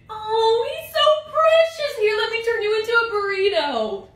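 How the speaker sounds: put-on high, gushing baby-talk coo. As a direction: coo in a high voice over a sleeping darling. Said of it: "different reactions I'd have if I walked in".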